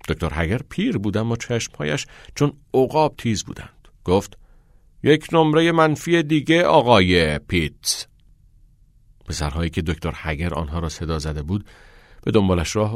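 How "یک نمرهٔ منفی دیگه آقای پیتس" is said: raised, high-pitched scolding; stern reprimand. A narrator's reading. Old teacher catching Pitts rolling his eyes, stern reprimand. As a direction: announce the penalty loudly and sternly. Sharp-eyed teacher gives another penalty.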